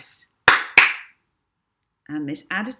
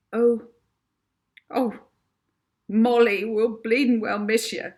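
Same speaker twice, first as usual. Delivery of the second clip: high, loud weepy wail; blubbering farewell, pitched high; 6 semitones higher, 9 dB louder than usual. A narrator's reading. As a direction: sob it out like a tearful housekeeper. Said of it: "Edna said weepily".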